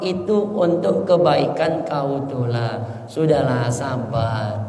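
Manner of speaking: put-on calm the upset child gently; soothing mother. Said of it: "Apa kata ibunya?".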